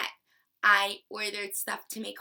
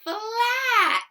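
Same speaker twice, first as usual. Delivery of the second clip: "Flat" blended and called out much higher and louder; excited answer; 12 semitones higher, 9 dB louder than usual. Shown as a demonstration. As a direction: reveal "Flat" with a big cheer. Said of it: "What's the word?".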